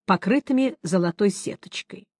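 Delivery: plain reading voice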